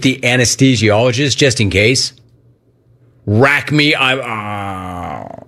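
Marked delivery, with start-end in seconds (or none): 3.3-5.4 mock drifting off under anesthesia; patient going under. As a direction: trail off into a long fading groan. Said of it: "I wouldn't make jokes with the anesthesiologist".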